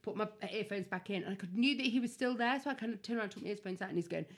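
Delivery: ordinary talk, nothing marked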